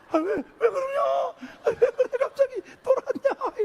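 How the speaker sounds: very high, loud panicked squeal; hammed-up frightened shriek; mock fright. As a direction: squawk in exaggerated alarm. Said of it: "겁내서".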